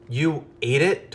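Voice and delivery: stunned disbelief, drawn out; a touch of shock from Tobias; gasped, horrified. A narrator's reading. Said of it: "Toxic Tobias gasped, horrified".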